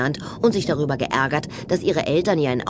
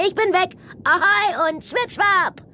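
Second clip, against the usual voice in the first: übermütig; 14 semitones higher than usual. A narrator's reading. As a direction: shout a gleeful goodbye while escaping. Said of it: "rief Mea übermütig". (übermütig, hohe Mädchenstimme)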